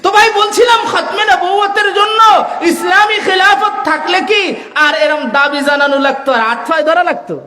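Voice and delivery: high, forceful oratory with stretched key words; impassioned preaching. Real, not acted. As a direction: thunder it out to the crowd in rising waves. (জোরালো চড়া গলায় ওয়াজ)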